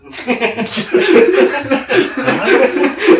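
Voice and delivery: high squealing burst of laughter; big laugh. Real, not acted. Friend cracking up over the show, real high laugh. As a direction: laugh out loud, high and long.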